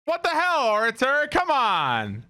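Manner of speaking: shrieking disbelief. Real, not acted. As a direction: squeal it like you can't believe your eyes. Shocked at in-game sight.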